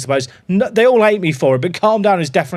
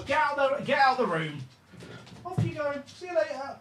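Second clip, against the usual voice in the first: lilting bye to the pet; high soft farewell to the dog; high, soft sing-song to the dog; 7 semitones higher, 13 dB quieter than usual. Real, not acted. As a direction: coo him out the door. Shooing the dog out.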